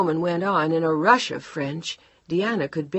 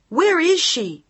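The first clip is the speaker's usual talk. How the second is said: much higher, louder shriek; 12 semitones higher, 8 dB louder, slower than usual. Acted. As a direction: shriek the question. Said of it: "Diana was shrieking".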